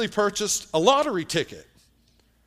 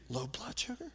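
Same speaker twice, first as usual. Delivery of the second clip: incredulous judge; 3 semitones higher, 13 dB quieter than usual. Retold or imitated. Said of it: "you could tell he was thinking".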